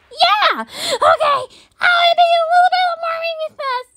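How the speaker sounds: high, eager cartoon puppy; quick high-pitched little character; excited toy puppy. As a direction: squeal with play-acted excitement.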